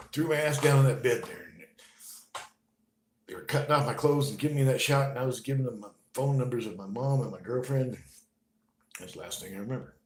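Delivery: usual speaking voice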